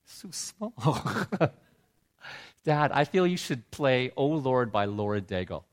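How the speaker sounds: ordinary talk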